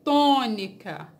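TÔNICA slowly, with a strong long TÔ; stressed first syllable. Shown as a demonstration. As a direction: lean hard on the TÔ of Tônica, then fall away. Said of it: "A sílaba tônica aqui". ("Tônica" devagar, com a sílaba TÔ mais forte)

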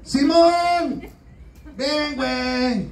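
high, drawn-out call; crying out to Simon. Retold or imitated. Jesus on the cross calling Simon, high and slow. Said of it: "le vuelve a gritar Jesús".

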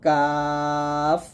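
long light "কাফ"; thin, normal. Shown as a demonstration. Teacher demonstrating the light letter, long thin "কাফ". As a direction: keep "কাফ" thin and drawn out. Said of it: "কাফটা নর্মালভাবে উচ্চারণ করতে হয়".

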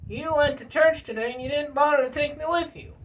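high, aggrieved puppet voice; Bill Clinton puppet. Acted. Puppet scolds handler for leaving him behind, aggrieved. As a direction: complain in a high put-on character voice. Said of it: "Bill Clinton".

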